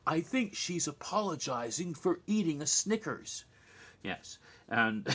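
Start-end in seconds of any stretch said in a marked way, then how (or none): 0.1-3.4 higher, put-on dopey dude voice; higher goofy Ted impression; Ted's voice. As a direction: play the clueless surfer dude. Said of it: "and Ted says".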